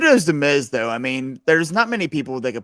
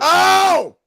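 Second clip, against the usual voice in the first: excited shout; 9 dB louder than usual. Real, not acted. Reacting to a big hit.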